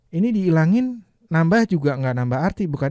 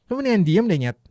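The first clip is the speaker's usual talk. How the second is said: higher, louder rude retort; emotional rude demo; 5 semitones higher, 4 dB louder than usual. Shown as a demonstration. Teacher demos the added swear word with an angry edge. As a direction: give it some angry edge. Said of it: "Cuman ngasih emosi aja".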